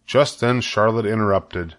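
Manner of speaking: plain reading voice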